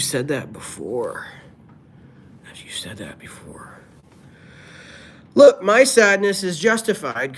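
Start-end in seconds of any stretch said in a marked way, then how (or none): 2.4-3.7 whisper. Pretend. Teasing a repeat commenter.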